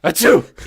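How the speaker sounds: loud, high fake sneeze. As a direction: sneeze it out on purpose. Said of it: "They don't know that that was me".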